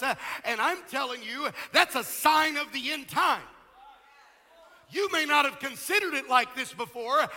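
loud, driving pulpit delivery; full-force preaching. Real, not acted. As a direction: hammer key words and stretch them out.

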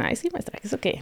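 lightly imitated pupil, high and soft at first; mock dismissive students. Skaters answering their coach's praise, mock dismissive. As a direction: toss it off as an unimpressed student would.

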